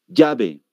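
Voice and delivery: "llave" said as "yabe"; Peruvian Y. Shown as a demonstration. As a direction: start "llave" with a Y sound. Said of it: "en Perú dicen".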